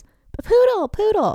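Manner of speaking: put-on high, loud child's voice gasping the safe word; urgent child. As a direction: blurt it out like a breathless little girl. Daughter uses safe word mid-tickle.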